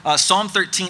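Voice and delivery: ordinary delivery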